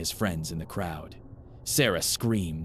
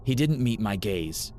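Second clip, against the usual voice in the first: ordinary delivery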